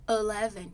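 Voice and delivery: "eleven" with a schwa first, said "alɛvɛn"; first vowel of "eleven" reduced to a quick schwa; American eleven. Shown as a demonstration. Teacher shows students the American "eleven" with schwa. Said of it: "Por exemplo".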